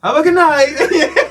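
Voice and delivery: high, loud call breaking into laughter; retold drawn-out sign-off yell; mock cheery shout. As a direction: holler it slowly across the park, amused. Retold calling back to heckler.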